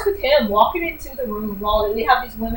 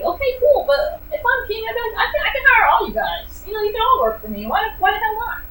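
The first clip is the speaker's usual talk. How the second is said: imitating the king; 11 semitones higher than usual. Voicing the king's reaction.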